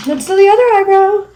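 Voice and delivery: sudden playful high-pitched outburst; odd silly voice. Pretend. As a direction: sing it out high and loud in a goofy voice. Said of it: "Why did I just talk like that".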